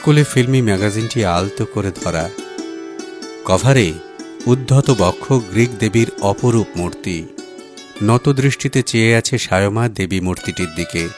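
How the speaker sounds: ordinary delivery, nothing marked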